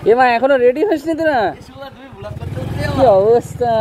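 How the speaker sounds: loud teasing call to friends. Real, not acted. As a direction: shout across to late friends. Calling friends who aren't ready.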